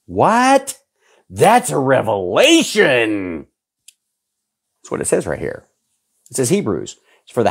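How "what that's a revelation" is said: mock surprise. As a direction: act stunned by the news. Feigned shock at a revelation.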